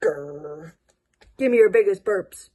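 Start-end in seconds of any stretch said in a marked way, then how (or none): 0.0-0.7 low playful monster growl; mock monster growl; low pretend growl. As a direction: growl like a little monster for the kids. Monster play with young kids.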